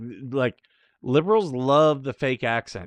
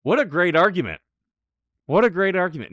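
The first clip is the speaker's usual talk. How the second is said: sarcastic; 7 semitones higher, 7 dB louder, faster than usual. Real, not acted. Sarcastic reply to clip.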